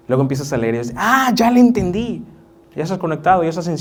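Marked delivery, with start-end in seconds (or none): 1.0-2.2 sudden realization. Retold or imitated. Reader suddenly understanding scripture.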